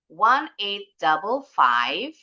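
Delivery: slow "one eight double five", lifted on "five"; rising intonation. Shown as a demonstration. Teacher showing another way to read the digits, rising intonation. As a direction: leave "one eight double five" open with an upward end. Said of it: "intonasinya naik".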